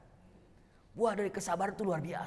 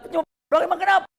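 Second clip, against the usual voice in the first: mock carefree shrug; 13 semitones higher, 11 dB louder, faster than usual. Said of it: "Gitu bilang".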